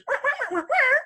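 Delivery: very high-pitched wordless wah-wah chatter; imitated garbled woman. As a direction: squeak out a woman's garbled talk as rapid wahs. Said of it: "all I hear is".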